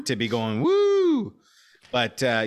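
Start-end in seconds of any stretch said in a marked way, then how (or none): 0.6-1.3 very high shrill whoo; train whistle woo. Pretend. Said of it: "Do a train noise".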